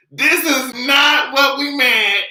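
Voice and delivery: high, loud, slow repeat; loud, slow disbelieving repeat; exasperated shout. Retold or imitated. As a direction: drag it out, outraged. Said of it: "repeat, louder and slower".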